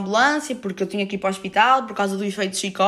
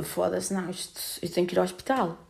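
much softer, panicked to herself; hushed panic; 2 semitones lower, 8 dB quieter than usual. Retold or imitated. Feeling tingling in her limbs, hushed panic. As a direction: hush the panic under your breath.

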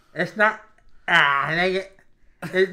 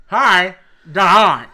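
Hi, the long line pushed out louder, half laughing; louder repeat; 9 dB louder than usual. Shown as a demonstration. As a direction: shout the clue again for the guesser. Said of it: "Why don't you enunciate".

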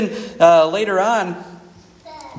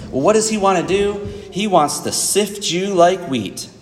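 ordinary talk, nothing marked

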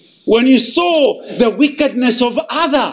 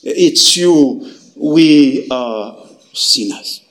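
contrite confession; 5 semitones lower, slower than usual. Acted. Disciples falling before Jesus in low, slow contrition. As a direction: lower and slowing, broken contrition. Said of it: "And they fall and say".

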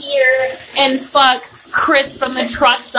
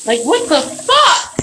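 exasperated; 7 semitones higher, 4 dB louder, slower than usual. Real, not acted. Exasperated outburst.

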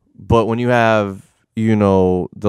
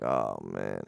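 very low, soft, resigned; retold dismay; 14 semitones lower, 15 dB quieter than usual. Lying cuffed in the mud, quiet resignation. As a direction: drop to a deflated low murmur.

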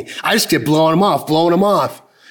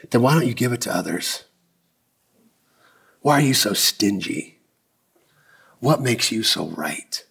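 soft rebuke; 8 semitones lower, 6 dB quieter than usual. Retold or imitated. God gently rebukes the preacher for judging others, low and quiet. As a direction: gently ask what makes him so right.

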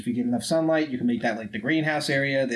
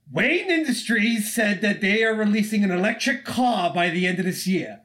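raised, angry Joker rant; hammed-up shouting about the car; imitating Joker yelling at Bruce; mock yell; 8 semitones higher than usual. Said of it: "Just yelling at him about the fucking car".

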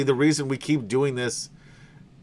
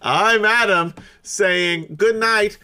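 slow, loud announcer sign-off; show sign-off; 3 semitones higher, 4 dB louder, much slower than usual. Acted. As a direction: draw out the farewell like a host.

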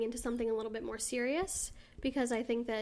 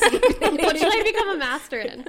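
high, loud eager question; excited; 7 semitones higher, 12 dB louder than usual. Real, not acted. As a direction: bounce up with mock eagerness.

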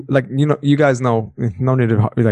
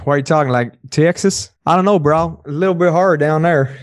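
Southern accent; 3 semitones higher than usual. Shown as a demonstration. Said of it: "Can you do the Southern accent?".

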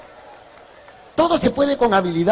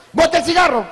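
firm scolding order, a bit higher; stern order; 6 semitones higher, 3 dB louder than usual. Acted. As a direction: tell the kid off firmly. Neighbor scolds teen smoker.

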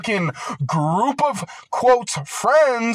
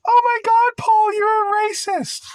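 squealing high mocking voice of an accuser, much higher and louder; mock critic; 3 dB louder than usual. Mocking critics calling him racist.

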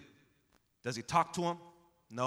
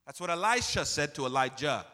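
usual speaking voice

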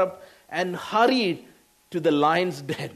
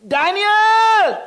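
high, loud desperate cry of a name; a king's anguished shout down into the den; anguished call; 10 dB louder than usual. Retold or imitated. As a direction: call out for him in despair from above the pit. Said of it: "It is anguished voice".

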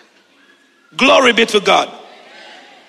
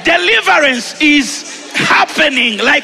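full-force preaching; 9 semitones higher than usual. Acted. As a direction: preach it out over the music, building.

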